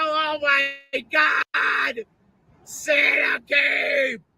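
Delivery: slow, high shouted joy; drawn-out high shout of joy; excited kid shout. Retold or imitated. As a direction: yell it like a kid on Christmas morning. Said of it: "and go".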